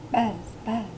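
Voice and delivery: light, soft imitation of bees; buzzing bees. A narrator's reading. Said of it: "The bees buzzed".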